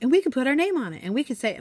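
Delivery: put-on eager kids. Kids eagerly suggesting names.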